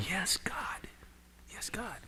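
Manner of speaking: hushed whisper to God; whispered prayer. Retold or imitated. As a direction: breathe the words, barely voiced. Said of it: "I was praying quiet".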